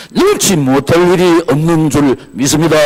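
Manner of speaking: loud, ringing declaration; full-force preaching. Real, not acted. Preacher affirming faith to church members, loud and emphatic. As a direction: proclaim it to the congregation with force.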